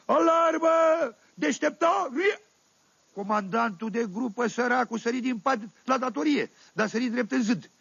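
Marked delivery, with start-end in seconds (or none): 0.0-2.4 drawn-out high yell, full lungs; a soldier shouting the alarm in the night, slow and loud. Retold or imitated. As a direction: bellow the alarm to wake the barracks. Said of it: "strigă Sucă cât îl ținură boșogii".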